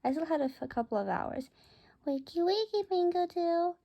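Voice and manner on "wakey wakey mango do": high, coaxing wakey-wakey; sing-song wake-up. Retold or imitated. Narrator wakes her sleepy bird Mango, high sing-song coaxing. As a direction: gently coax a sleepy bird awake.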